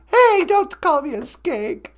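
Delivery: high squeaky wife protest. Acted. Wife snapping back at being called skank, squeaky.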